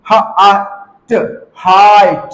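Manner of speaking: ह, आ, ट apart, then long हाइट; sounded out slowly. Shown as a demonstration. Teacher showing learners the आई sound, slowly blended. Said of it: "आई pronounce करेंगे".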